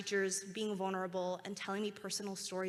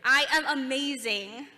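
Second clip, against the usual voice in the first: slow, loud, high and proud; slow shouted affirmation; loud confident affirmation; 5 semitones higher, 11 dB louder than usual. Shown as a demonstration. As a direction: stretch it out like a rally cry. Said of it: "your loudest, most confident voice".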